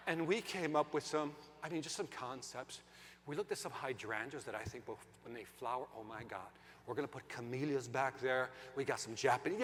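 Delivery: low, hushed, dreamy presentation; salesman's pitch. Retold or imitated. Landscaper describing garden concepts to the couple, hushed reverent pitch. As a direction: murmur the plans as if awed by them. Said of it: "He starts describing it".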